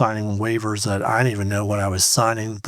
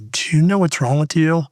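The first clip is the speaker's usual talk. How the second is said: higher and louder, pressing; worried wife; 6 semitones higher, 4 dB louder than usual. Retold or imitated. Wife on the phone pressing her husband, higher and louder. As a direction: press him hard with a high, loud question. Wife calling about the diagnosis.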